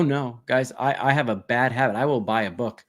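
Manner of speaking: ordinary talk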